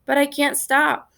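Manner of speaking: usual speaking voice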